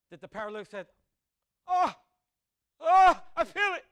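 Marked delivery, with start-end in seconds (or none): 1.7-3.9 slow, loud, hammed-up gasp of being healed; mock miracle reaction. Paralytic mocked as if feeling healing, hammed-up and loud.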